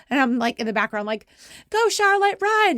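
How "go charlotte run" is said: loud, high cheer at a player; screaming encouragement. Retold or imitated. Mom on the phone shouting at her daughter to run. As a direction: yell her on down the court.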